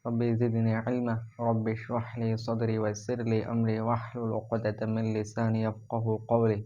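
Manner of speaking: low, soft Arabic dua recitation; measured Arabic prayer recitation; recited opening prayer. Acted. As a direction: recite the opening blessings calmly and devoutly.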